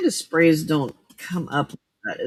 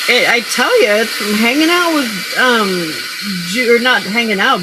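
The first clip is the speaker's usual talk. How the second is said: laughing loud; 3 semitones higher, 9 dB louder than usual. Real, not acted. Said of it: "started Southern accenting".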